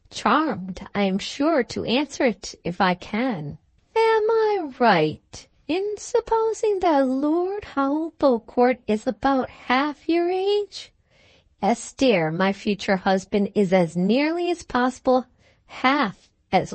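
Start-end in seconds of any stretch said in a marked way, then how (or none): 4.0-10.9 disapproving friend. A narrator's reading. Friend questioning the bride about the age gap, high and pointed.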